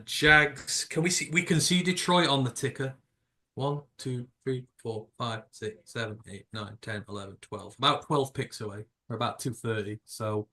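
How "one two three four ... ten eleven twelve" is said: counting down. Real, not acted. Counting picks, fading.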